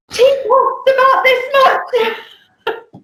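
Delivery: put-on high, slow little kid voice. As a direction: play the small boy, drawn out. Mimicking the child later.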